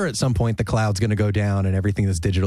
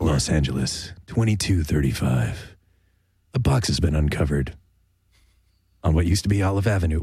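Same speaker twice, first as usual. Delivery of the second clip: deep, dramatic trailer narrator; movie trailer voice; 7 semitones lower than usual. Pretend. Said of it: "I'll do a movie trailer voice".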